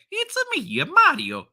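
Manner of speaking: very high falsetto video-game plumber; classic Mario. Acted. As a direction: squeal it high like the game's Italian plumber. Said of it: "If his voice doesn't sound like".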